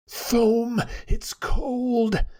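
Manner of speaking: high, shivering cry of cold; frightened abbot shivering in the unnatural cold; teeth chattering. A narrator's reading. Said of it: "chattered Paslo".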